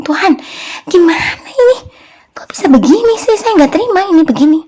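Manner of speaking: high, loud, indignant protest; retold outburst, pitch leaping up in exasperation; angry complaint. Preacher re-enacting his quarrel with God, angry complaint. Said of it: "Saya marah-marah sama Tuhan".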